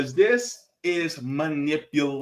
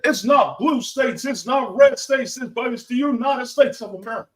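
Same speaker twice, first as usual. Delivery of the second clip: loud, high rally-speech impression; mock political orator, loud and high; Barack Obama; 8 semitones higher, 4 dB louder than usual. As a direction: imitate a rousing convention speech, pitched up and punchy. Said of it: "it was Barack Obama that said".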